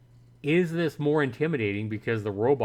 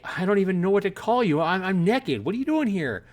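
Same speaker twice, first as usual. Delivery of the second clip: panicked scream; 8 semitones higher, 3 dB louder than usual. Pretend. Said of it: "kinda scream at him and say".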